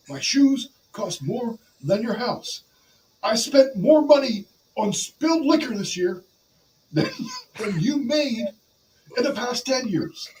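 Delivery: high, drawn-out wrestler's brag; Ric Flair. Acted. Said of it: "it's kinda in the cadence".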